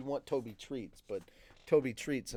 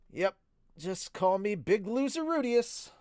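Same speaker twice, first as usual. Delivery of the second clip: self-mocking; 9 semitones higher, 5 dB louder than usual. A narrator's reading. Hero dubbing himself a loser, self-mocking. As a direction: draw it out high, making fun of yourself. Narrator mocks himself in story.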